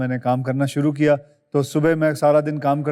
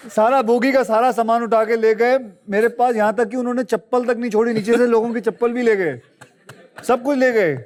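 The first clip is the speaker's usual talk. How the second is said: excited retelling; 7 semitones higher than usual. Real, not acted. Telling the host how the dacoits took everything, voice up high and excited. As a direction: pile it on, amazed at how much they took.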